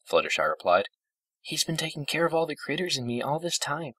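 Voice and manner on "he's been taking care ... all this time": high, gentle character voice; gentle old pony, grateful. A narrator's reading. Fluttershy praising Discord to her friends, high and gentle. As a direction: speak up high, grateful and sweet.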